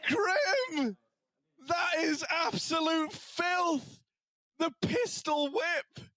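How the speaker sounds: high, drawn-out cries of amazement; thrilled shouting. Real, not acted. Caster celebrates a player's clutch, high thrilled exclamations. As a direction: savour each phrase in a high, awestruck shout.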